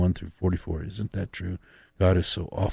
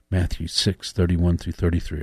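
ordinary talk, nothing marked

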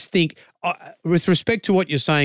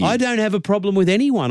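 heated; 7 semitones higher than usual. Real, not acted. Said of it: "You're getting louder and louder".

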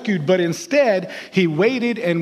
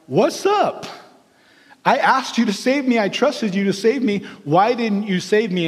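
high, aggrieved why-didn't-you; indignant complaint; 3 semitones higher than usual. Acted. Drowned man confronts God at heaven's gates, indignant and high. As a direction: demand an answer, wounded and baffled.

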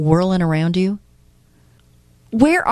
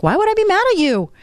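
retold question, pitched way up; high-pitched disbelief; 13 semitones higher, faster than usual. Speaker replaying her answer to her friend's worry, puzzled protest. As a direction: act out puzzled protest, much higher. Acted phone call with friend.

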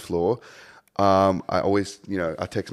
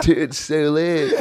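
high, slow, wasted party voice; drunk reply; 6 semitones higher, slower than usual. Retold or imitated. The drunk DJ answers his manager, slow and slurred. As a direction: drag it out like you're hammered.

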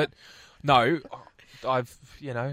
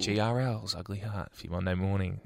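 lower, softer, understated radio back-announce; playing it cool; 5 semitones lower, 6 dB quieter than usual. Shown as a demonstration. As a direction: tone it right down and announce coolly. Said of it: "Play it cool".

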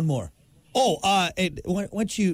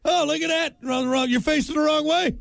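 high mocking heckler voice; 10 semitones higher than usual. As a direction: jeer at the lefty golfer. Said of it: "we got a lefty here".

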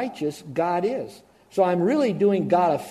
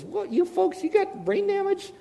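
much higher, mocking disbelief; incredulous apostle. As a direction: scoff at them like they've lost their minds. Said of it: "he really speaks to Christians and he says".

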